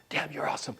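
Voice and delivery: whispered inner voice. Retold or imitated. Presenter's inner voice praising him, whispered. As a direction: breathe it like a voice in your head. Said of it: "Your little voice is going".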